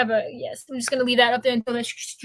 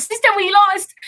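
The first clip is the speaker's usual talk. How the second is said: higher mock Wakandan accent, light and playful; imitating Wakanda accent; 6 semitones higher, 3 dB louder than usual. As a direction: put on the Wakanda accent for a friend's line. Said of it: "talking like people do in Wakanda".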